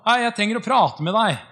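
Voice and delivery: mock call to get the pastor's attention. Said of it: "hun bare roper på Yong Gishu". (gjenfortalt rop, høyere og sterkere)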